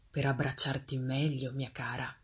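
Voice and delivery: falsely tender storybook wolf; low, sly reassurance to the child; wolf posing as granny. A narrator's reading. Wolf poses as grandmother.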